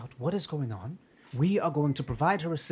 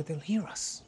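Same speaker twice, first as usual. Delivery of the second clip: ordinary talk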